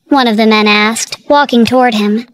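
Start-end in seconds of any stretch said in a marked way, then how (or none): none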